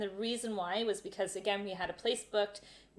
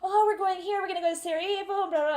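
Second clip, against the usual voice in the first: high, loud gushing tourist; hammed-up excitement, pitched up and loud; really animated; 9 semitones higher, 7 dB louder, faster than usual. Traveller bluffing a border guard, really animated. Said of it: "really animated".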